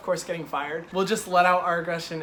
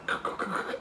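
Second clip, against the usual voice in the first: stifled snicker; 7 dB quieter than usual. Real, not acted. Laughing at the memory.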